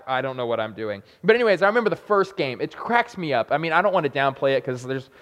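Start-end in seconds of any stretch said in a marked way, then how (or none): 0.1-1.0 quiet aside. Retold or imitated. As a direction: drop low and soft, a secret panic. Panicked freshman's inner thought.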